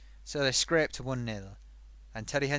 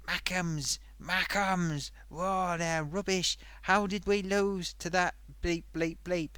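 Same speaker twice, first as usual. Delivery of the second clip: lightly imitated ranting football fan; mock rant, raised pitch and drawn-out words; True Geordie; 5 semitones higher, slower than usual. Puppet host mocking a sweary fan YouTuber, light ranting impression. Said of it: "you know the way he talks".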